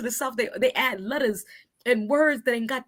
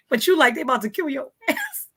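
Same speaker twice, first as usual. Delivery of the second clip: usual speaking voice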